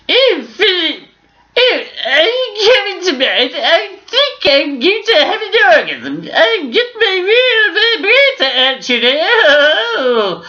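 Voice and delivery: camp, breathy-sounding royal moaning to her husband; the Queen voice pushed way up high. Acted. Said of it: "the Queen".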